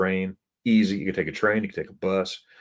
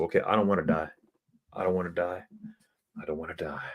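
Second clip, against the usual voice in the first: fading to very soft; meek surrender; 5 dB quieter, faster than usual. Retold or imitated. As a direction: give in, each repeat quieter. Retold meek surrender, repeated, fading.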